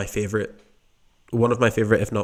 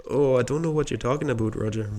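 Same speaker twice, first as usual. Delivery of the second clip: put-on Canadian accent, a little higher; 3 semitones higher than usual. Shown as a demonstration. Podcast host showing off an accent on request, mock Canadian. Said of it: "You do, you do really good Canadian accent".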